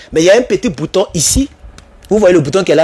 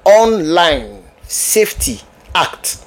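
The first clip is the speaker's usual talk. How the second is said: very slow, word by word; slow repetition; much slower than usual. Shown as a demonstration. Naming the British law for viewers, slow and separated. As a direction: spell out the name slowly, pausing between words.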